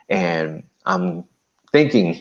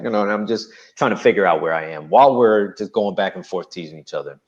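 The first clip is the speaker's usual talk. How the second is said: normal talking voice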